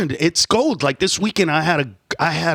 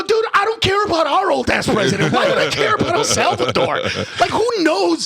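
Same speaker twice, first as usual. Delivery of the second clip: dismissive retort; 10 semitones higher than usual. Retold or imitated. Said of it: "I go".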